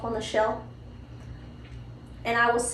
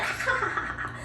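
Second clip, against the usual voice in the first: high mock toddler giggle, then fading; imitated toddler giggle; 10 semitones higher, 5 dB quieter than usual. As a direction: giggle like a carefree two-year-old. Toddler laughing with green tongue.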